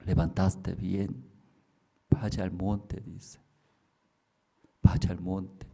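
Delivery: a lightly voiced hidden voice giving orders; low mysterious voice. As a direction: speak like an unseen voice in the dark.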